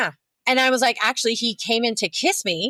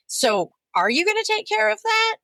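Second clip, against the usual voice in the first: mock sweet question; 12 semitones higher than usual. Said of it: "it's still happening all the time".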